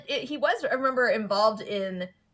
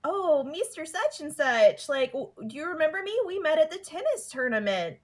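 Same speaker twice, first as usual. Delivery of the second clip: put-on light Brooklyn-tinged girly gush; [name]'s voice; 7 semitones higher than usual. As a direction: gush at him like a starstruck fan. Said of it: "she's like".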